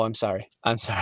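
mock Canadian apology, soft; Canadian accent. Shown as a demonstration. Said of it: "I've tried doing Canadian".

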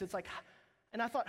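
ordinary delivery

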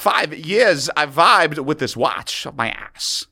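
slow, halting celebrity impression; Christopher Walken. Riffing for a friend, slow celebrity impression. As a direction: deliver it as a slow, odd monologue. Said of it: "That's all my Christopher Walken".

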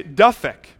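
Dufek said as "DUFF-ek"; mispronounced surname. Retold or imitated. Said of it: "people will sometimes say".

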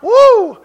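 loud, high "woo"; exultant whoop. Real, not acted. Preacher infused with strength, exultant whoop. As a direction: let out a high celebratory whoop.

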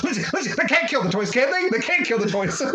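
fast, high panicked pleading; mock a frantic plea to a friend; half-laughing mock panic, rising high on the question; retold panic. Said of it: "I shake her, and I go".

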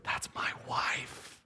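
hushed, slow whisper of wonder; awed whisper. Retold or imitated. Groom watching his bride walk down the aisle, awed whisper. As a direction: breathe it out in awe. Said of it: "and he goes".

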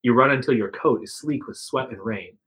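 ordinary talk, nothing marked

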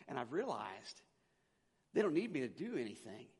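ordinary talk, nothing marked

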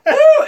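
high whooping cheer, laughing; mock cheer. A gamer whoops with laughter at his loading bar, high and loud. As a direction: whoop like the game finally loaded.

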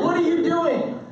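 put-on high child's demand; pestering kid. Kid knocks on bathroom door, high insistent mock child voice. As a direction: imitate a kid calling through a door. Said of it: "They'll knock".

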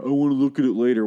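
mimicking Kane's nonsense reason for the camera; mocking Kane. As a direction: sneer it like a dumb excuse. Mocking Kane's lame excuse.

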